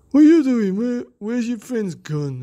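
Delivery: high loud bully demand, slowing down; angry bully shout. Acted. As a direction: bark it like a big bully.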